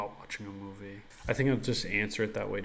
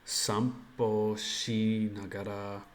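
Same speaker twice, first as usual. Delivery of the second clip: slow tentative Japanese; much slower than usual. Acted. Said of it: "So would I just say".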